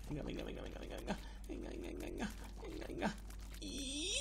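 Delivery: breathless whispered venga venga; whispered urging. Real, not acted. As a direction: urge it on under your breath. Urging under tension.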